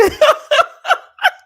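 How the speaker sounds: high loud burst of laughter; real loud laugh. Real, not acted. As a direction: crack up at the shade. Laughing at the shade.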